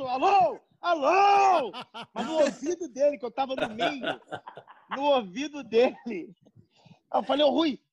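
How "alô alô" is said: loud, higher shouts into the phone; shouting hello. Retold or imitated. Prankster shouting into the phone beside Rui's ear, loud shouts. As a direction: bellow hello right in his ear. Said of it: "Fala mais alto".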